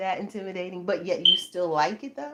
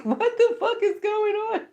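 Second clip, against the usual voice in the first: high, loud laughing exclamation; laughing disbelief; 13 semitones higher, 5 dB louder than usual. Real, not acted. Mom retelling her reaction to the guy, laughing loudly.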